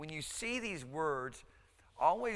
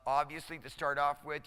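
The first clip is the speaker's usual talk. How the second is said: usual speaking voice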